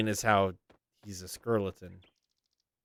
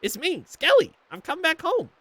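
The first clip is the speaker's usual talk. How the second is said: much higher cartoon skeleton voice; skeleton grandson. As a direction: announce yourself, pitched way up. Skelly answers grandma.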